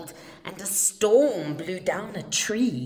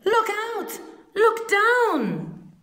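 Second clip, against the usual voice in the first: very high, loud warning cry; high urgent yell; 13 semitones higher, 6 dB louder, slower than usual. A narrator's reading. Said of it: "who yelled".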